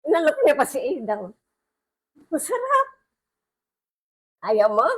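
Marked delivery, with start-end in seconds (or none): 2.3-5.0 tempting whisper-like coax. Acted. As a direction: tease him with the forbidden taste. Eve tempting Adam with apple. (malambing na pang-aakit)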